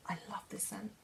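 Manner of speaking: breathy whispered aside, voice dropped right down; hushed, dreamy whisper. Real, not acted. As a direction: whisper it as if savouring the scent. Smelling perfume, whispered to camera.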